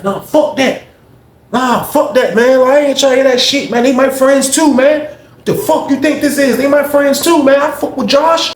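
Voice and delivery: high, loud, defiant yell; angry shouting. Retold or imitated. Zach yelling at Neil that they're his friends too, angry shout. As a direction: shout him down, defending your friends.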